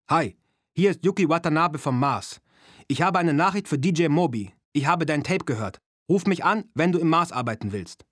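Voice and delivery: put-on loud, high-pitched Japanese-accented voice; punchy phone message in a Japanese accent; broad Japanese accent. A narrator's reading. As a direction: play a caller with a thick Japanese accent. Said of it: "mit einem breiten japanischen Akzent".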